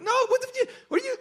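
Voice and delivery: very high squeal of disbelief; incredulous protest. Retold or imitated. Husband answers his disapproving wife, incredulous. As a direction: jump way up in pitch, sputtering.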